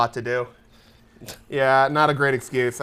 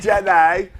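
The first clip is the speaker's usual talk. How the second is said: Australian accent; 7 dB louder than usual. Shown as a demonstration.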